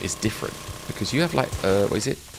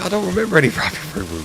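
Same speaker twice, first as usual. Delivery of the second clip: fast, higher, laughing denial; mock streamer; 12 semitones higher, 6 dB louder, faster than usual. Streamer brushing off Robbie Roo Roo, quick and high. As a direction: rattle it off like the confused streamer. Imitating streamer denying memory.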